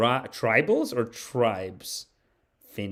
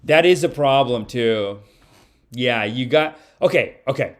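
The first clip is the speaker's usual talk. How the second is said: ordinary talk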